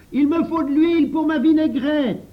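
put-on loud, high boyish shout; high, forceful call for supplies; shouted demand. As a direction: holler the order across the garden. Pierre shouts an order.

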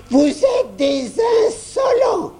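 put-on high, slow shrieking old lady; furious grandmother shout. A narrator's reading. Grand-mère yells at the insolent children, high furious shriek. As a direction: scream it at the cheeky kids. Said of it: "cria grand-mère".

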